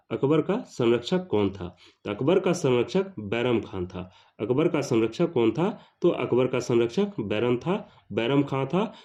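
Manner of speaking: ordinary talk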